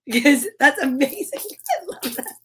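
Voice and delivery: talking through real laughter; laughing. Real, not acted. Host delighted by messages, laughing as she talks. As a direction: let the words break up into giggles.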